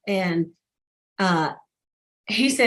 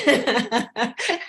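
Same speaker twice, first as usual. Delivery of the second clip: real amused laugh; 3 semitones higher than usual. Real, not acted. Recruiter joking about signing her back up, laughing. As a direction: laugh at your own recruiting joke.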